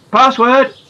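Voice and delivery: shouting the password; yelled. Acted. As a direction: yell it through the door. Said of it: "yelled six voices".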